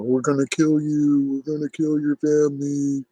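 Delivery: hammed-up taunting of the harassers; mocking the trolls. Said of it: "always the same thing over and over".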